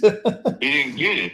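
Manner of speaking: says it through a real laugh, slowly; laughing as the words come out. Real, not acted. Laughing to the host about the clueless buyer.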